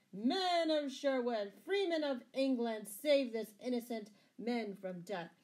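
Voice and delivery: rally your men across a crowd; shouted rallying call. A narrator's reading. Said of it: "and shouted".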